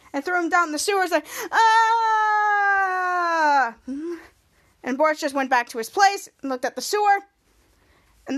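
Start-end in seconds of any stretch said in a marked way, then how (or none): 1.5-3.8 very long high scream, slowly falling; acted wail fading down a drain. Hero thrown down the sewer, falling scream.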